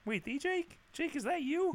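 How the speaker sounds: high, soft incredulous squeak; high-pitched mock disbelief; amazed caller. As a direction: act out a caller stunned to reach him.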